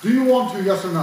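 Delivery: high, loud pushy demand. Real, not acted. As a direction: bark the question at him. Pushing friend to fight.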